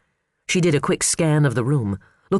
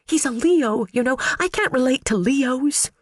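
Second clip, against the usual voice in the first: put-on high, panicky girlish voice; nervous high little voice; timid young secretary; 11 semitones higher than usual. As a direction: squeak out an anxious apology. Phoebe's voice continues.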